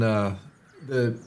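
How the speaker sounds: usual speaking voice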